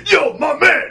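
high, loud mimicked call; retold high-pitched holler; imitated inmate's holler. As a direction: shout it out as an impression of the inmate. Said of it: "I'm like this, I go".

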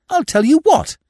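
loud, high burst of excitement; excited exclamation. Acted. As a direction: blurt the idea out loud. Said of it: "Not so loud".

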